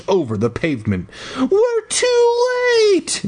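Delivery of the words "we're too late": very high, squealed mock wail; hammed-up falsetto cry of despair. A narrator's reading. Brother finding his friend lying there, shrill mock cry. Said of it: "Daniel cried".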